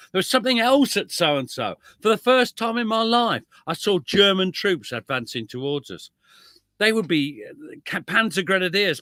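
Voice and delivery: reading a quote. Acted. Reads veteran's war quote.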